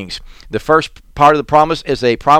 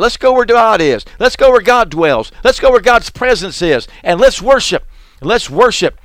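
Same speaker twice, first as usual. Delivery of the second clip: high, loud rallying preacher cadence; preaching at full force; 6 semitones higher, 4 dB louder than usual. Real, not acted. Preacher urging his flock, fervent call to worship. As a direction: rouse the church to go worship.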